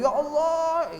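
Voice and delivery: a high, shouted "Ya Allah"; shouting "Ya Allah" at God, shown as wrong; mock shouted prayer. Shown as a demonstration. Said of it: "teriak-teriak".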